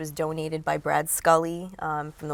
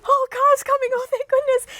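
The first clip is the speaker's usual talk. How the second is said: much higher and louder, thrilled each car arrives; high excited relief; 12 dB louder than usual. Retold or imitated. To herself as helpers drive up, a high thrilled squeal.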